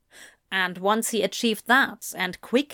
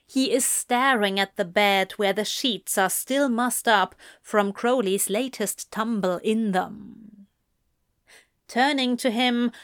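plain reading voice

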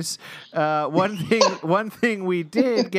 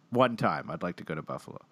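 quiet; 9 semitones lower, 7 dB quieter, much faster than usual. Real, not acted. Wistful trailing aside.

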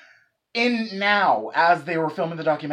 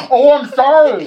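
gasping, much higher and louder "sorry"; startled apology; 11 semitones higher, 17 dB louder than usual. Real, not acted. Caught doing an accent, gasping high apology. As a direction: caught off guard, yelp it. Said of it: "gasp after being called out".

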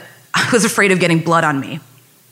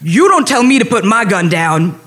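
imitated officer yelling back, indignant; mock yell, hard on the first words; retold yell; 2 semitones higher, 4 dB louder than usual. Police officer refusing neighbour's request, yelled back. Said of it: "the guy yells back".

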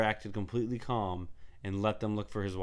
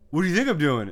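snappy retort; 7 semitones higher, 9 dB louder than usual. Retold or imitated. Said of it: "She goes".

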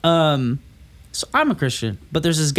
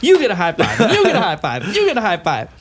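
mock game-show generosity; 5 semitones higher, 3 dB louder than usual. Mimics handing out high fives.

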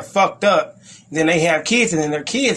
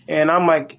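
normal talking voice

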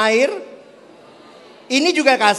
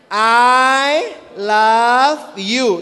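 very slow, drawn-out I love you; drawn out, showing the sign for I love you; slow demo; much slower than usual. Shown as a demonstration. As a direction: stretch each word of I love you as you sign it. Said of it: "Untuk American Sign Language".

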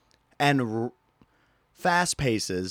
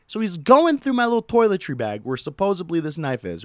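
ordinary talk, nothing marked

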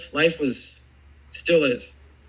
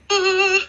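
awed ah; 4 dB louder than usual. Retold or imitated. Awe at locker room door.

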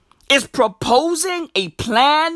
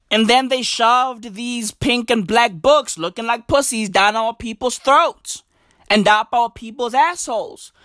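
usual speaking voice

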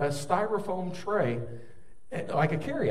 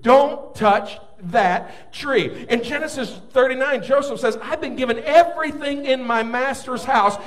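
loud, raised-pitch sermon at full force; impassioned preaching; 7 semitones higher, 9 dB louder than usual. Real, not acted. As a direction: preach it out, stretching the key words.

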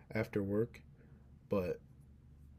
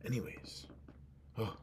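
whisper; 6 dB quieter than usual. Real, not acted. Aside after apology.

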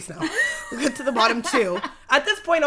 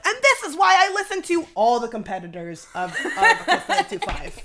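raised, higher-pitched outburst; mimicked angry heckler; 9 semitones higher, 5 dB louder than usual. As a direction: rant like a fed-up man in the crowd. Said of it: "who was right in the crowd goes".

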